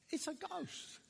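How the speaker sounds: high, softened gasp of fright; terrified hushed cry. Acted. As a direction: say it small and high, scared. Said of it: "and they're terrified".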